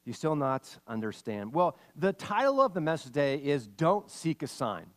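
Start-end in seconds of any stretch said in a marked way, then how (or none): 0.0-1.5 Jesus voiced. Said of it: "He said to them".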